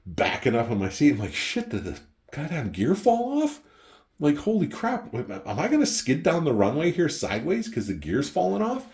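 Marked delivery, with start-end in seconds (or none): 1.3-8.8 high-pitched mock panic; rising "holy crap" disbelief, hammed up; alarmed retelling. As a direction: act out a pilot's sudden alarm on landing. Retelling his alarm while landing.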